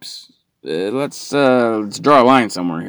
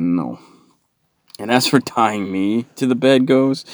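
ordinary delivery

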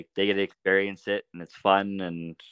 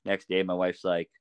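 ordinary talk, nothing marked

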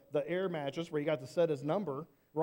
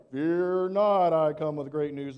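loud, stern "Fear not"; booming angel; 3 semitones lower, 7 dB louder, slower than usual. Pretend. Angel shouting at scared shepherds, mock booming. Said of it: "he probably didn't do it that way".

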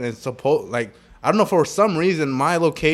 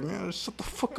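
much softer, sleep-mumbled brush-off; imitating half-asleep; 6 semitones higher, 14 dB quieter than usual. Still asleep on the phone, mock groggy dismissal. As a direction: act out talking from sleep. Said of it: "like, still asleep".

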